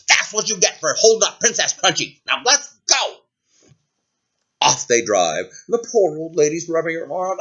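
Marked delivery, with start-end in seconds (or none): 0.1-3.2 scolding royal voice, pitched higher; imperious, unsympathetic command; haughty princess. Acted. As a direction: gloat and order everyone onward. Princess scolds, orders departure.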